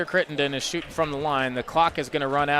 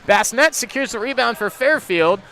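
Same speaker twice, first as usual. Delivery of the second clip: energetic live game call, building on shots; play-by-play; 3 semitones higher, 5 dB louder than usual. Acted. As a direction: call each move as it happens.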